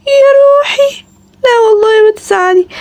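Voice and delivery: high coaxing comfort; tender comfort. Acted. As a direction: soothe a sad child. Comforting a sad little girl.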